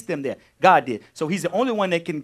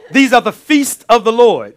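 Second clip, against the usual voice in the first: loud, high preaching proclamation with a laugh; proclaiming; 4 semitones higher, 9 dB louder than usual. Acted. Preacher booming the scripture to the congregation, loud. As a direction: declare it to the whole room.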